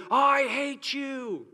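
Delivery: put-on high, slow childish yell of hate; mock kids yelling. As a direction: imitate kids fighting, shrieking it. Kids screaming at each other.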